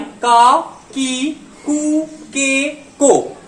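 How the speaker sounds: का की कु के को drawn out one by one; slow syllable demo. Shown as a demonstration. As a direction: read का की कु के को slowly like a teacher. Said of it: "ये प्रोनाउंसिएशन बहुत इंपॉर्टेंट होता है".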